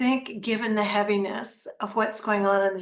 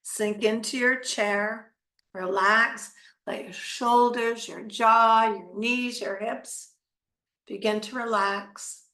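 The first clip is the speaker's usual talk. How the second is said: right in your ear; slower than usual. Acted. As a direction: ease them into relaxation. Leads a guided meditation.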